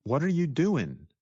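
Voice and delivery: "What are you doing" run together, ending "doin'"; fast speech, dropped G. Shown as a demonstration. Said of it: "talking even faster".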